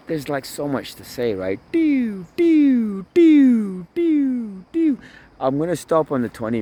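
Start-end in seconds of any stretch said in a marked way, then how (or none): 1.7-5.0 piercing, high sliding "dew", repeated; loud high notes, each swooping downward; high falling "dew" calls. Real, not acted. Vlogger marking the stop point, high falling calls. Makes sound to mark a cut.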